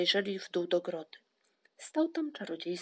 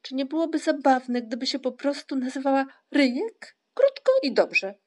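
loud, high, eager pleading; insistent bid to be heard; calling out; 6 semitones higher, 7 dB louder than usual. A narrator's reading. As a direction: push your own idea loudly and high. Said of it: "wołał Ryjek".

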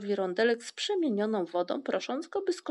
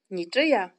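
loud, triumphant announcement; proud, emphatic answer; 8 dB louder than usual. A narrator's reading. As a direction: declare it loudly, with triumph. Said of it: "odparł triumfująco tatuś Muminka".

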